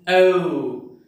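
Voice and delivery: "O" drawn out, gliding from one vowel to the next; "O" as a diphthong. Shown as a demonstration. Teacher demonstrates two sounds as one, "O" as a glide. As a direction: glide slowly through "O" as in old. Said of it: "are pronounced".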